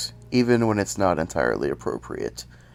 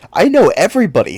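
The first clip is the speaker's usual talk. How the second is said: louder, higher and fast; angry outburst; 9 semitones higher, 10 dB louder, faster than usual. Real, not acted. Comic fan ranting at viewers, loud indignant outburst. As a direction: blurt it out, offended at not knowing. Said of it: "that small outburst".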